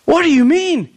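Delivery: high, incredulous question; baffled, pitch jumping up; acted disbelief. Sister questioning Jesus about rising now, acted disbelief.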